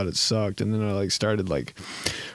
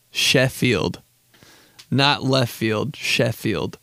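usual speaking voice

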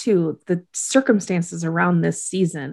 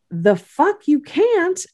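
sassy retort; 10 semitones higher, slower than usual. Retold or imitated. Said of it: "And she's like".

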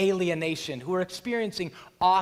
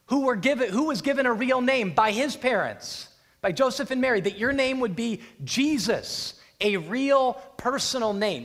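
loud, raised-pitch proclamation; emphatic declaration; 5 semitones higher, 4 dB louder than usual. Acted. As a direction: declare it forcefully as the possessed man. Voicing the man's declaration to Jesus.

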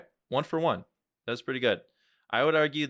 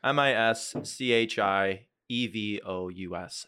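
slow, letter by letter; spelling it out; slower than usual. Shown as a demonstration. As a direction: spell each letter out slowly.

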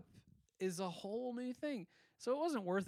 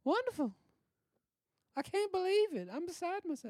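put-on wholesome 1950s kid; 11 semitones higher, 4 dB louder than usual. Acting gushing starlet.

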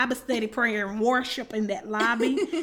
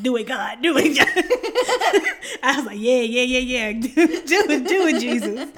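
mock fervent prayer; 2 semitones higher, 10 dB louder than usual.

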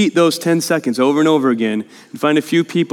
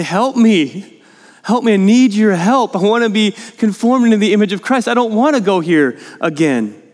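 urgent, higher-pitched cry for help; emphatic, emotional; 5 semitones higher than usual. Acted. Preacher models praying for help in temptation, emphatic and emotional. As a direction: plead earnestly, pressing each request. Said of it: "all that I just said so emphatically and emotionally".

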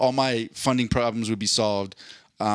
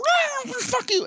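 very high, slow mock cat; a cat sneering at the man; slower than usual. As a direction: squeal it like a rude cat. Cats voiced talking back.

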